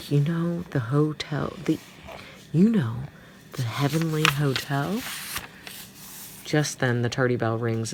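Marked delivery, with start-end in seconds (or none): none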